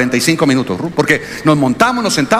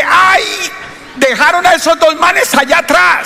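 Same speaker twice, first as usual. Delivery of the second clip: mock shout; 12 semitones higher than usual. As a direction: shout it out, pitched way up, amused.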